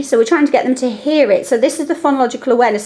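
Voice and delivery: ordinary delivery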